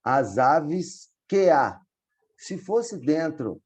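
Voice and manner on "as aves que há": "as aves que há" said slowly, with "que" and "há" merged into one syllable; slow demo of the vowel merge; joined syllables. Shown as a demonstration. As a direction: glide "que há" together as the verse is spoken. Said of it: "Aqui nesse exemplo em cima".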